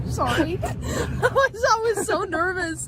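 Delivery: high, helpless nervous laughing; nervous laughter. Real, not acted. Apologizing after the scare, laughing nervously. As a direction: apologize through real nervous giggles. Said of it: "I laugh in nervous situations".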